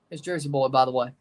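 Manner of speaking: ordinary talk, nothing marked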